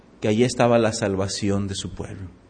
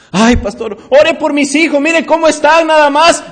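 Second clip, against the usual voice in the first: high, loud complaining churchgoer voice; mock whiny church member; 13 semitones higher, 9 dB louder than usual. Church member asking pastor to pray for her kids, mock whiny. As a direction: ham up the excuse-making member.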